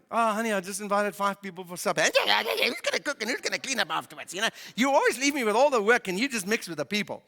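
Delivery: lightly voiced nagging wife; a hint of a grumbling spouse, put-upon; mock complaint about guests coming; complaining wife. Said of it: "My wife doesn't talk like that".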